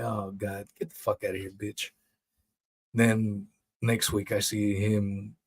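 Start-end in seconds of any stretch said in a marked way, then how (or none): 0.0-1.9 much softer, disgusted mutter; fed-up dismissal. Retold or imitated. Host fed up with the excuses, soft disgusted mutter. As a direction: brush him off under your breath. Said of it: "And then I'm like".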